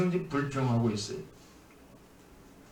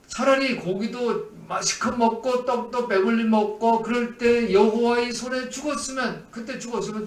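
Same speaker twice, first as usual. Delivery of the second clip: grumbling Israelites; 6 semitones higher, 4 dB louder than usual. Retold or imitated. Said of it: "그렇게".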